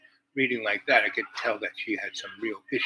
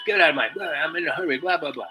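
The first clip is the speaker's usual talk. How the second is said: mock rant, pitched up at the start; imitated ranting; 6 semitones higher, 4 dB louder, faster than usual. Woman going on at someone in a hurry, imitated ranting. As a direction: mimic someone rattling on impatiently.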